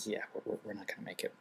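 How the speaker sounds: resigned whisper at the checkpoint; hushed, quietly giving up on the flight. Retold or imitated. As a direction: breathe it out, voiceless and defeated. Resigned aside while queuing.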